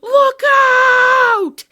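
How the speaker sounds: very high, very loud cry; warning shout. Acted. Professor warning the boys of the shadow, loud shout. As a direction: bellow the warning. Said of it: "he cried".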